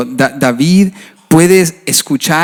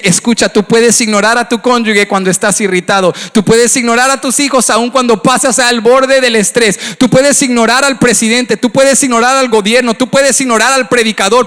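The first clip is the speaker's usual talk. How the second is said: higher, rising preacher's cadence hammering each puedes; fiery preaching; 5 semitones higher than usual. Acted. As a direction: build the refrain like a revival preacher. Preacher builds a rising refrain.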